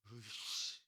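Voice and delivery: a low, muttered 으이씨 of jealous fury; seething grunt. Shown as a demonstration. Preacher showing the original word's force, low seething 으이씨. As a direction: shake with envy on 으이씨, held in. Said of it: "어떤 강세가 들어가 있냐면 이런 거야".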